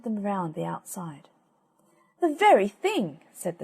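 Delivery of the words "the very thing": chirpy sparrow. Acted.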